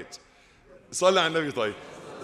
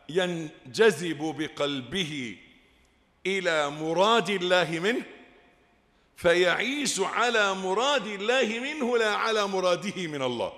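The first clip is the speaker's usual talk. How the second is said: normal talking voice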